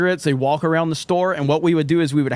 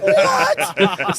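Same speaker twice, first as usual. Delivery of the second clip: very high squeaked exclamation; stunned disbelief. Retold or imitated. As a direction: squeal it in comic shock. Retold shock at manager's confession.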